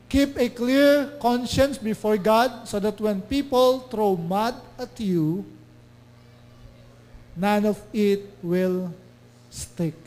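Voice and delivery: slow, loud verse reading; scripture reading. Acted. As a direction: read it out slowly with weight. Reads scripture slowly. (mabagal at malakas na pagbasa ng talata)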